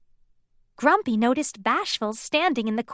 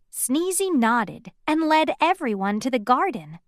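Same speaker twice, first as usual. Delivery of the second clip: plain reading voice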